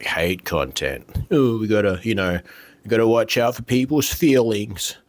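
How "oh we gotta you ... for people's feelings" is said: sarcastic mockery. Sarcastic jab at the platform.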